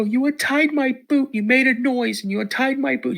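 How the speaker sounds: put-on high frightened kid accusing; a panicked kid blaming dad; scared child. Boy accusing dad of untying his boot, high and scared. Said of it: "I go".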